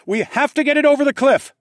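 high, loud urgent shout; shouted response. A narrator's reading. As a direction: yell the plan over the chaos. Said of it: "I responded".

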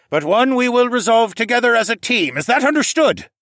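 high, loud rallying address; commanding raccoon captain. Acted. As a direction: project orders to the whole crew.

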